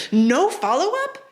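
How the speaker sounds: high, slow disbelief; incredulous. Real, not acted. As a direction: ask it as if you can't believe it. Incredulous at the movie dialogue.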